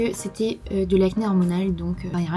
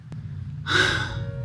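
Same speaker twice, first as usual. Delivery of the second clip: weary sigh; 8 dB quieter than usual. Real, not acted. Dismayed about her teeth.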